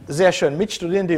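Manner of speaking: ordinary delivery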